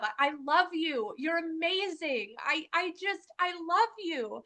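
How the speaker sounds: high, loud, overflowing declaration of love; gushing love. Acted. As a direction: pour out the love as if your heart is bursting. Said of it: "our body is just radiating love".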